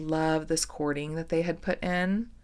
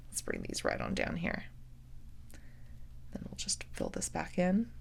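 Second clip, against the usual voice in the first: whisper; 10 dB quieter than usual. Real, not acted. Arranging beads quietly.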